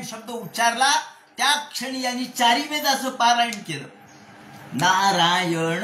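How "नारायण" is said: NARAYAN slowly drawn out, each syllable separate. Shown as a demonstration. Preacher counts out four syllables of the name, syllable by syllable. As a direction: stretch Narayan into its separate syllables. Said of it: "हे चार शब्द आहेत, चार अक्षर".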